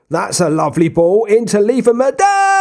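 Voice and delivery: real match-commentary excitement; excited commentary. Real, not acted. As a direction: shout the goal chance. Goal build-up, commentating.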